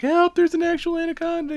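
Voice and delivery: put-on high panicked squeal of a fleeing girl; panicked intern. Intern fleeing the snake, high panicked cry. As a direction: shriek it like a terrified intern running out.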